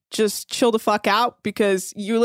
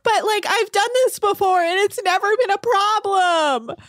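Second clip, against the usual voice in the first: high whiny imitation; high mocking whine; mocking whiny stewardess; 9 semitones higher than usual. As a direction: whine it like a self-excusing crew girl.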